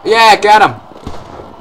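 loud, high, drawn-out cheer; triumphant shout. Real, not acted. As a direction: shout your victory slowly. Hits target in game.

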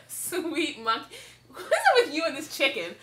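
laughing through the words. Real, not acted. Giggling at the chicken prop.

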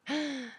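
high, sudden mock gasp; amazed gasp. Sim reacting to magic, with an acted gasp. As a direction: gasp as if wonder-struck.

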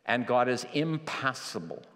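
ordinary delivery

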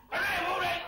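put-on high, loud coaxing call to the baby; coaxing shout to baby. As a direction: call out brightly to hush the baby. Father coaxing crying baby. (gọi to dỗ con)

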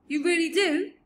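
high, loud, delighted disbelief; thrilled, astonished giant; suddenly brightening. A narrator's reading. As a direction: burst out with hopeful joy. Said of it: "suddenly brightening".

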